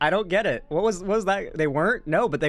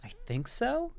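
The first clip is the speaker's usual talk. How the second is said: quiet, high, questioning mock reply; unsure boomer parent; 6 semitones higher, 7 dB quieter than usual. Clueless boomer answering the TV ad, unsure. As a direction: answer softly with a rising, clueless uncertainty. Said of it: "boomers would be like".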